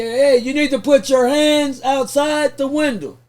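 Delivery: loud, slow cop order through a bullhorn. Retold or imitated. Police officer orders driver over bullhorn, loud slow command. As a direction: bark it slowly like a throwback bullhorn. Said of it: "talking to me on a fucking bullhorn".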